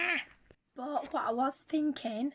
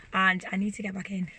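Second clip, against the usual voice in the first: normal talking voice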